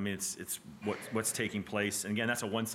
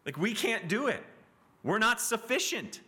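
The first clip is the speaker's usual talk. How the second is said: very high, stretched-out plea; crying out; 12 semitones higher, 4 dB louder, slower than usual. Acted. Sacrificial animals admitting they cannot save, crying out. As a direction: preach it as an impassioned cry of inadequacy. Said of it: "they're also saying".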